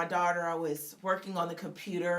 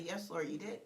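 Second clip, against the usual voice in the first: humble reply; 4 semitones lower, 10 dB quieter than usual. Retold or imitated. Answering God humbly.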